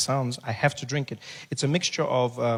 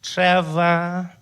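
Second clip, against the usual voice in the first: louder, higher drawn-out echo; unfriendly receptionist; 5 semitones higher, 7 dB louder than usual. Acted. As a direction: repeat the name back as a surly receptionist. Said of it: "She's not friendly at all".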